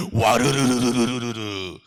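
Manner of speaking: loud, slow rolling rumble; crumbling walls sound. Shown as a demonstration. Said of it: "무너지는 소리를". (크고 느리게 무너지는 소리)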